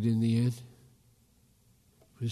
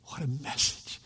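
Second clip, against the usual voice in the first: hushed, unvoiced wonder; awed whisper; 6 dB quieter than usual. Real, not acted. Preacher marvelling at the message, in a whisper. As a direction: let it fall to a breath. Awed aside after the call.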